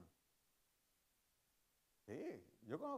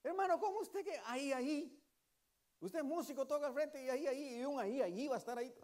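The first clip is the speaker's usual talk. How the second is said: lightly voiced church sister, higher, chiding; sister scolding; 10 semitones higher, 6 dB louder than usual. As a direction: playfully scold a brother who only says "ahí". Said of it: "y un día la hermana".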